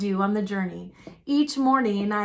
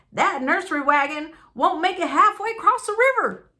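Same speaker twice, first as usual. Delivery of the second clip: scoffing mockery; 6 semitones higher, 4 dB louder, faster than usual. A narrator's reading. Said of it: "scoffed someone else".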